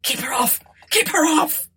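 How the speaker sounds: high panicked cry, rising on the repeat; terrified plea. A narrator's reading. Tabitha begs Martha for protection, terrified. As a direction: cry out to fend off a ghost.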